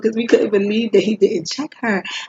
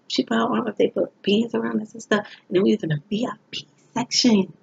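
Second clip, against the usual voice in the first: ordinary talk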